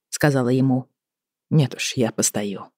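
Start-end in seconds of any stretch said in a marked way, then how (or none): 1.5-2.7 cold. A narrator's reading. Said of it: "ответил Вейс холодно".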